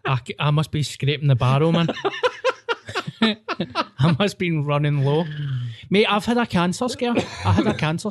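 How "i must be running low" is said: slow words through a laugh. Real, not acted. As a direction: chuckle while saying it. Laughing at own joke.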